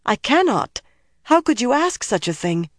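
high, distressed protest; high-voiced, appalled refusal; anguished refusal. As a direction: refuse in horror, pitched high.

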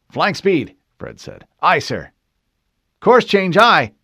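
loud, high crisp replies; crew acknowledgments. Acted. Helmsmen acknowledging the admiral's orders, loud and crisp. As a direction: sound off like a sailor at his post.

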